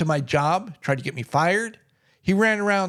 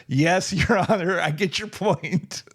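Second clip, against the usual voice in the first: slow, knowing yes-sir; mock-dutiful, drawn out; wry mock obedience. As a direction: humor the judge with a dry, slow reply. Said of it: "It's like".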